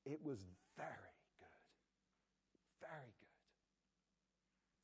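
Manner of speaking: breathless, slow whisper of wonder; awed whisper. Acted. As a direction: hush it like a marvel. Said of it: "he exclaims".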